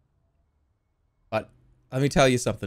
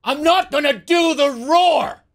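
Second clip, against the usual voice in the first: slow, high, loud screamed line; a drawn-out shriek of a stranger yelling; retold scream; 11 semitones higher, 5 dB louder, slower than usual. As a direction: scream it out from behind the door. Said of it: "she just screams".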